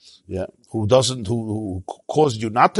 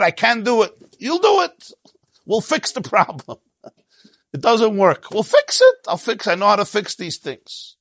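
God brushing off the complaint, worked up and high; excited mock exchange; 9 semitones higher than usual. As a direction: play both sides with rising excitement. Acting out Moshe and God exchange.

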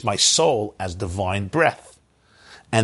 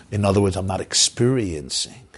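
usual speaking voice

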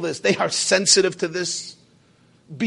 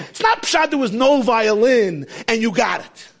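ordinary talk, nothing marked

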